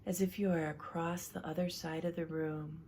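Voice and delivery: calm relaxation coach leading a breathing exercise; guided relaxation. Acted. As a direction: guide them gently through breathing, unhurried. Leads a guided relaxation session.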